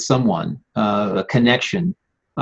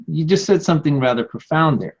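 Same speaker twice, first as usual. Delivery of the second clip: usual speaking voice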